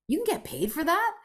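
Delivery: high, astonished question; amazed. Retold or imitated. Drug rep hearing about life coaching, high and amazed. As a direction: marvel that the job exists. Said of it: "And I'm like".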